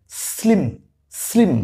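teacher modelling স্লিম after spelling it; modelled word. Shown as a demonstration. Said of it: "স্লিম কিভাবে হলো?".

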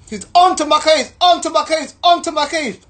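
high, loud repeated complaint; mock exasperation. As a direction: hammer it again and again, fed up. Mocking repeat of the nagging officer.

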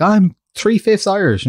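drawn-out claim of Irish heritage; mock American. Imitating Americans claiming Irish roots, slow mock boast. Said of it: "they're like".

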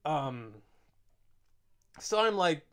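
normal talking voice

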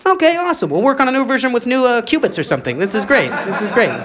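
high, fast, strained cheer; forced upbeat scientist. Acted. Rebuffed scientist faking cheer about qubits, high and forced. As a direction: force the enthusiasm, pitched high. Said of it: "scientist answers".

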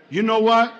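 low, understated acted disappointment; calm breakup opener. Woman ready to get rid of her man, calm and low. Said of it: "start off as a normal conversation".

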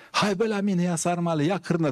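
high, calling hawker's cry; market hawker call. Retold or imitated. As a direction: shout out your wares like a stall vendor. (strigăt de precupeț, pe ton înalt)

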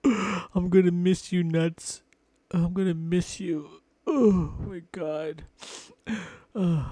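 high, loud fake sobbing goodbye; hammed-up crying. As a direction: blubber it out like a cartoon mourner. Mock crying farewell to squirrel.

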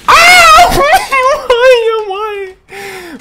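high, loud wail of protest; anguished scream. Real, not acted. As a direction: cry out as if robbed. Killed suddenly in game.

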